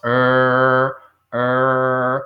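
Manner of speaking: loud "er" with the tongue curled back; English r sound. Shown as a demonstration. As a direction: growl out "er" like a bark. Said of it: "la jalamos y hacemos". ("er" fuerte con la lengua curveada hacia atrás)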